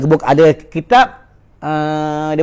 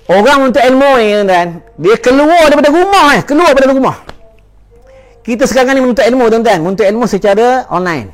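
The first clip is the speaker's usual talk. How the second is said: full-force preaching; 6 semitones higher, 4 dB louder than usual. Acted. Preacher urges congregation to seek knowledge, fervent and loud.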